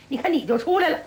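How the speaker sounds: ordinary delivery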